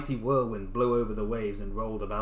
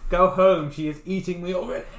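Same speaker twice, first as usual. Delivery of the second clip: puffed-up pufferfish; 4 semitones higher, 7 dB louder than usual. Acted. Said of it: "this fish is a puffer fish".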